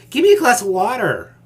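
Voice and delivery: loud, high, harsh command; barking demand. Shown as a demonstration. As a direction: snap it out like a bully. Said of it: "Do you see the difference?".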